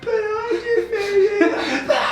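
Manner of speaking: high, wailing fake cry, drawn out; mock sobbing. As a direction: blubber it like a hammed-up mourner. Mock mourning for Lagertha's death.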